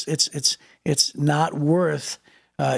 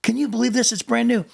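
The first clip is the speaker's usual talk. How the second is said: exasperated complaint; 7 semitones higher than usual. Retold or imitated. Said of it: "I wanted to be like".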